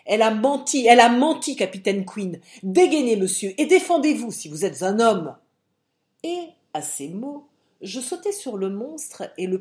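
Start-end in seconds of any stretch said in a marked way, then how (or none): 0.0-5.4 furious shouted accusation and challenge; loud, outraged cry. A narrator's reading. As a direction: shout it hard, challenging a rival to fight. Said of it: "en criant".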